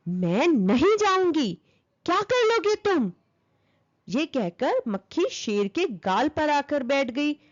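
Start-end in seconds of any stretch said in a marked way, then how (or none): 0.1-3.1 defiant taunt, rising high at the end; a cheeky, angry little fly daring the lion. Acted. The fly angrily defies the lion, high taunting voice. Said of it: "मक्खी गुस्से में बोली".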